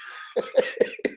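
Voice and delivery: a high breathless giggle; high airy giggle at the story; high breathy laugh. Real, not acted.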